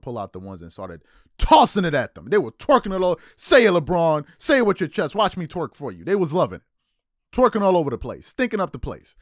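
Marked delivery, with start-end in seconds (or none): 3.4-6.0 loud hyped-up fan chant, imitated; mock cheering fans. Imitating fans hyping LeBron up, loud mock cheering. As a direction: shout it like a fan at courtside.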